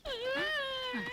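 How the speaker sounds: high wailing sob; crying wail. Acted. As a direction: break into a loud sob. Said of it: "அழாதம்மா, இப்படிதான் கதையை கேட்டு அழுதுடுறா".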